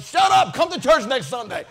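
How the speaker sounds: loud, high shout; loud mock shout at a passerby; yelling back. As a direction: snap back at the heckler. Brother Pinnachetti yelling at passerby.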